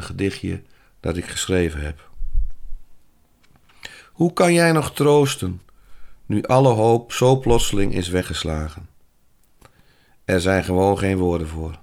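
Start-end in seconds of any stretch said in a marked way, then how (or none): 4.1-11.8 licht plechtig voorgedragen gedicht; gedicht voordragen. Acted. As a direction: draag het gedichtje ingetogen voor. Reads her own poem. (eigen gedicht ingetogen voorgedragen)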